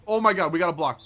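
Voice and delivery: loud burst of joy at the block; excited shout. Real, not acted. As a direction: yell it out thrilled. Big defensive play.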